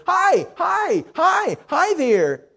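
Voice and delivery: put-on high, gleeful baby voice; happy baby babble. A narrator's reading. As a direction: chirp it like a laughing toddler. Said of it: "Eric continued to laugh and answer".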